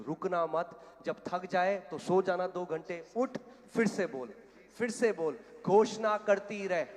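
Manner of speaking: loud acted command, building to a shout; urgent exhortation. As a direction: drive her on, insistently. Acted urgent orders from the minister.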